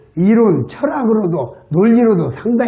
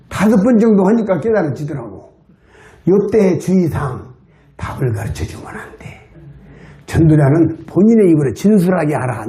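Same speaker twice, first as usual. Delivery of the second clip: ordinary delivery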